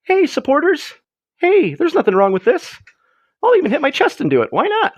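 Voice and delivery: lightly playing Elon Musk, waving to supporters; a hint of Elon Musk doing his wave. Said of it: "he did the little Elon Musk wave".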